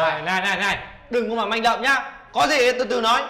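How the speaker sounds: loud call to calm down; calming shout. Acted. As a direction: step in and shout them apart. Breaking up a fight.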